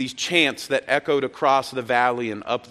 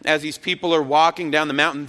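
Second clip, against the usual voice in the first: ordinary talk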